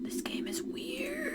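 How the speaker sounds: whispered aside, slow; conspiratorial whisper. Real, not acted. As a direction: lean in and whisper it to the audience. Aside to viewers during gameplay.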